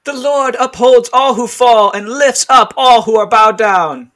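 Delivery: very high, loud put-on voice; a high, loud, piercing voice from a window; shrill queen voice. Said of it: "if the mother had that voice".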